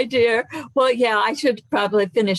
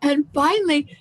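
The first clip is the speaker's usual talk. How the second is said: normal talking voice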